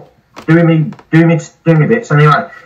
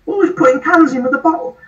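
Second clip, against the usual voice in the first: exasperated mock; 13 semitones higher than usual. Mocking careless bin users.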